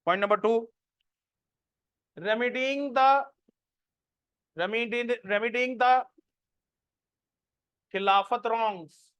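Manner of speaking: slow, spaced dictation with long pauses. Acted. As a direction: dictate each phrase slowly so students can write it down. Dictating notes to students. (धीमी आवाज़ में notes लिखवाना)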